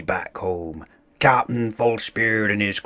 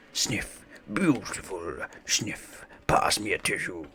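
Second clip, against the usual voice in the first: soft sniffling whisper, choked up; pirate weeping quietly at the dolphin ballet; teary whisper; 8 dB quieter than usual. Acted. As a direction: whisper it through mock sniffles. Swinetoes moved to tears by dolphins.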